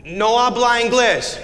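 put-on slow, high Spanish accent. Defendant voiced again.

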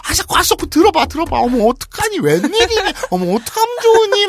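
high, loud, breathless-to-tell gossip; hammed-up housewife bursting with scandal; frantic "you won't believe this" fussing; gossipy ajumma. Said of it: "막 완전 아줌마들 그거 있잖아요".

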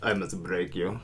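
deep, hushed Russian menace; Russian accent. Shown as a demonstration. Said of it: "very different than your normal accent".

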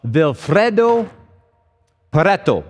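name said in a hammed-up Italian accent; a playful, overdone Italian accent on the name; bad Italian accent. Shown as a demonstration. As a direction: roll out Vilfredo Pareto like a mock Italian. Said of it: "my really bad attempt at a, at an Italian accent".

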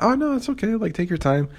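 mock sweet reassurance. Retelling reassuring the drunk girl, high sugary voice. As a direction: coo it sweetly like a nice guy. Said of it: "And I was like".

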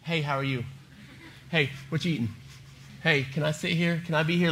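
low, soft voice of a dog sidling up; hushed, clingy pet dog; needy dog. Acted. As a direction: sidle up casually, asking to be close. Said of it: "Remy's like".

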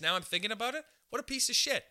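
ordinary talk

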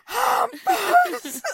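hammed-up angry dad shout; dad yelling. Dad shouting at his son across the table, mock yell. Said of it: "pappa bara".

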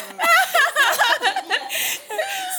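long high-pitched burst of laughter; high peals of real laughter. Real, not acted. Loud laughter in conversation.

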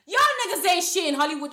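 loud, high angry rant; indignant outburst. Real, not acted. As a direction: let the outrage burst out.

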